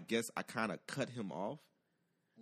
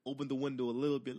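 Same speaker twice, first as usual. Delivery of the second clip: ordinary delivery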